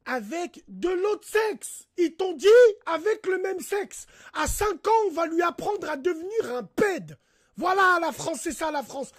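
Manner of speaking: shouting in outrage; outraged shout. Real, not acted. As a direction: blow up in indignation. Outraged at school program.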